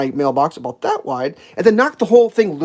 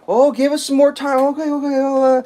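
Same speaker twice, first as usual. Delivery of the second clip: high, whiny take on the workers; mock pleading; 8 semitones higher than usual. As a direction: plead in a higher, put-on voice. Mocking workers begging for time.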